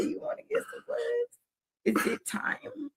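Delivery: high, breathless whisper; a teasing whisper to a little girl; whispered mock question. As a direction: hush it like a secret. Mocking question to daughter, whispered.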